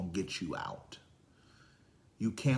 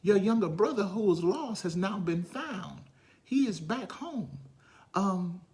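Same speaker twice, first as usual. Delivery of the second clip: high, building to a loud slow peak; excited servant; 12 semitones higher, 8 dB louder, slower than usual. Acted. Servant telling the elder brother about the party, building excitement. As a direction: build the news until it bursts. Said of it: "And it's like".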